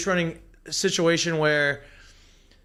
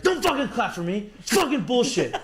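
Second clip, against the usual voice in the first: higher, half-laughing mock rant; angry outburst; 6 semitones higher than usual. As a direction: retell the blow-up with a laugh in it.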